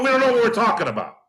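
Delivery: high, fast sneering mimicry of critics; mocking whine. Host mocks critics who doubt him, in a high, fast sneer. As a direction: toss the critics' words back in a high, fast mock voice.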